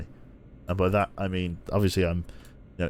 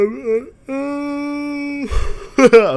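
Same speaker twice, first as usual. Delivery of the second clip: long, high, loud yawn; 9 dB louder than usual. Real, not acted. Involuntary yawn while streaming.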